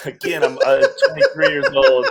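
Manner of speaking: talking through a laugh. Real, not acted. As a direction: laugh it out, half embarrassed. Laughs while recalling plan.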